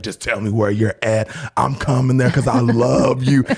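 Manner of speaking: pleading husband impression. Husband calling wife who left, mock pleading. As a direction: act out his urgent, lovesick insistence.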